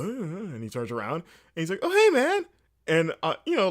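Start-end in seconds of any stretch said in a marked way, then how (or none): 1.8-2.4 bright high friendly greeting, louder; super friendly. Retold or imitated. Greg turning around to greet a stranger, super friendly. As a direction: greet a stranger as warmly as you can. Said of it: "super friendly".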